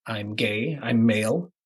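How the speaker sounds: usual speaking voice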